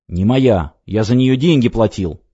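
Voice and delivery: ordinary delivery, nothing marked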